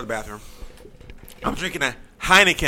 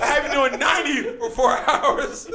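shouting through laughter; laughing shout; 10 semitones higher than usual. Real, not acted. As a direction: yell it while laughing.

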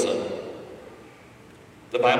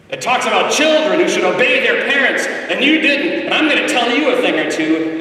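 preaching rant; 8 semitones higher, 5 dB louder than usual. Retold or imitated. Mocking a scolding parent.

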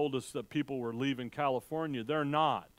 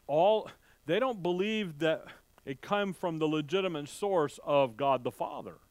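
usual speaking voice

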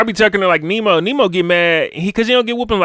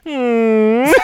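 squeaky held-in hum bursting into a laugh; stifle a laugh with a high hum; high mock hum then laugh; 6 semitones higher, 4 dB louder than usual. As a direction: kid holding in laughter. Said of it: "Ricky said".

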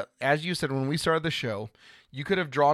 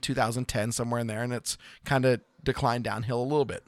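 ordinary delivery

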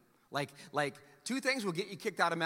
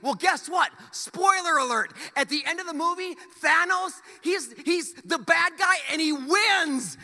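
high, loud, slow gloating reveal; gleeful spoiler shout; 9 semitones higher, 6 dB louder than usual. Acted. Pastor ranting spoilers at the church, loud and gleeful. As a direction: announce the spoiler with mock triumph.

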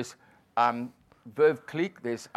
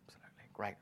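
hushed, much softer starstruck reply; awed whisper; 4 semitones lower, 19 dB quieter, much faster than usual. Retold or imitated. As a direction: murmur it in awe.

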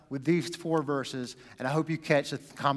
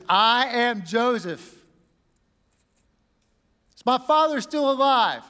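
emotional outburst; 6 semitones higher, 6 dB louder than usual. Acted. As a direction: let it burst out of you. Joseph reveals himself, emotion explodes.

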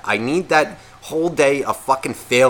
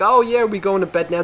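higher-pitched dog asking hopefully; eager dog; 7 semitones higher than usual. Acted. Dog asks owner about bedtime, higher and eager. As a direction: play a dog coaxing its owner to bed. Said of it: "crank my neck a little bit".

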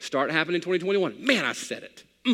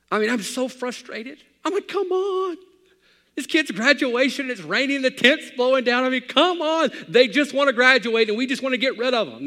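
high, louder exasperated venting; preacher acting out his graduation-day frustration; exasperated outburst; 9 semitones higher, 4 dB louder than usual. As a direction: throw up your hands in mock frustration. Pastor voicing his own frustration.

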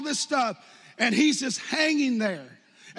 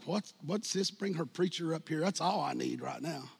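muttered resentment; 6 semitones lower, 8 dB quieter than usual. Retold or imitated. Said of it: "they're like".

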